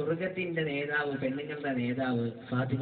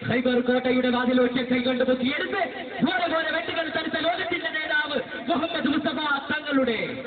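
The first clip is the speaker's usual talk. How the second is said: rising, forceful sermon delivery, louder and higher; fiery preaching; 10 semitones higher, 6 dB louder than usual. Acted. Preacher extols Ali to the congregation, fiery preaching. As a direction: thunder the praise of the hero to the crowd.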